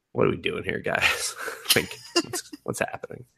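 breathy, laughing whispered bafflement; retelling baffled viewing, laughing breathlessly; laughing disbelief. Retold or imitated. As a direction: ask it through giggles, barely voiced. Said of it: "And I was just like".

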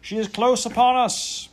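high, loud alarmed shout; terrible cry. A narrator's reading. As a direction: scream the warning from the stern. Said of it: "cried out in a terrible voice".

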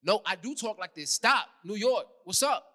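put-on punchy, defensive New York accent; playful New York accent, short bursts. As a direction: insist on it in New York street talk. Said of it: "You don't talk like that regularly".

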